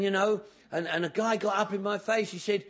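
ordinary talk, nothing marked

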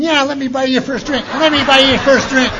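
imitating Rotten Ralph, laughing; mock tempting offer. Ralph mockingly offering a drink.

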